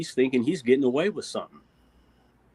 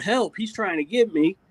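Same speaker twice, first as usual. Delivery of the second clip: mock cry for help; 6 semitones higher than usual. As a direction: act out calling for help, half-hearted volume. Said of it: "you better yell that shit out".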